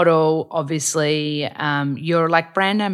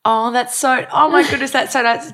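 delighted gush; 8 semitones higher, 4 dB louder than usual. Real, not acted. Touched by a compliment.

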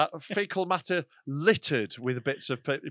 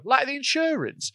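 mocking; 10 semitones higher, slower than usual. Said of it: "people go".

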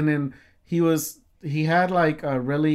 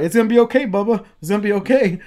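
raised, louder acted reassurance; retold urgent reassurance; 5 semitones higher, 7 dB louder than usual. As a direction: call out comfort as if to the injured. Friend reassuring wounded man, retold.

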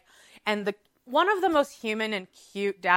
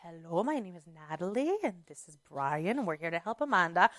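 gentle paramedic; 3 semitones lower, 7 dB quieter than usual. Retold or imitated. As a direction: soothe a frightened patient. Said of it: "it's like".

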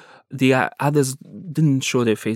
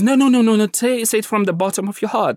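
high, loud, urgent no no no; insistent captor; 10 semitones higher, 3 dB louder, faster than usual. Retold or imitated. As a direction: insist he mean it.

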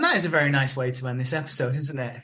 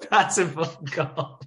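laughing; 4 semitones higher, much slower than usual. Real, not acted. Repeating the joke, laughing.

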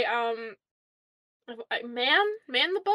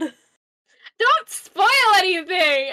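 loud, high, drawn-out objection; loud protest; 5 semitones higher, 7 dB louder, much slower than usual. Real, not acted. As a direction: cut off a spoiler with a slow wail. Protest at co-host's possible spoiler.

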